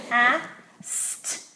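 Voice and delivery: Ast sounded out slowly and separately; sounding out. Shown as a demonstration. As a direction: sound out Ast like a phonics blend. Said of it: "sound it out and spell it".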